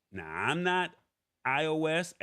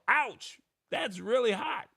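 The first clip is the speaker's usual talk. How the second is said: expressive exclamation; 10 semitones higher than usual. Acted. Reading out a sample phrase for viewers, expressive exclamation. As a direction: exclaim it like you just got burned. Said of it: "with the occasional expressive".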